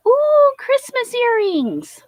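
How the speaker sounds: high, slow gasp of delight. Real, not acted. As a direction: gasp and coo over the earrings. Delighted at earrings.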